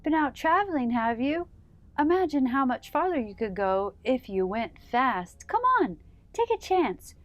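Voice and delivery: high, loud, bubbly dolphin voices; playful dolphins. Acted. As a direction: coax her to go fast, squeaky and eager. Playful dolphins tempt Harriet.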